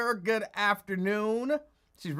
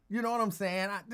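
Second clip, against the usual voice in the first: ordinary talk, nothing marked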